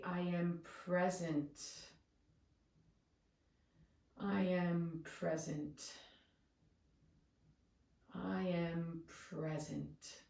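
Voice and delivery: slow affirmation with "present" drawn out; calm, weighted final word "present"; stress on PRESENT. Shown as a demonstration. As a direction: glide through "I am" and settle long on "present". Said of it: "And then finally".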